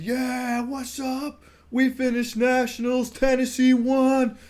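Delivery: high, loud pep-rally announcement; hype speech. Retold or imitated. Narrator hyping up the Tennessee tent crowd, loud and high. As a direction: pump up the crowd like a hype man. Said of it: "give 'em this hype speech".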